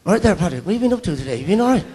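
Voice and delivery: lively Irish relative chattering; a light Irish uncle's greeting; a hint of Irish lilt; Irish relative's accent. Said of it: "They go".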